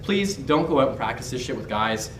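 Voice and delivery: ordinary talk, nothing marked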